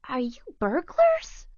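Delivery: high little dog voice; dog character voice. A narrator's reading. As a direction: ask warily like a small dog. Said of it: "asked Sally Dog Little".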